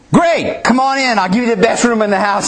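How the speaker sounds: high-pitched, hammed-up innkeeper enthusiasm; overeager welcome. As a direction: throw the doors open with a big, high "Great!". Boy innkeeper sabotages play with eager welcome.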